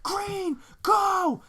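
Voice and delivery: high yelled command; yelling at driver. Retold or imitated. Punching the wheel and yelling at the stopped car, high shout. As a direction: bark it at the car in front.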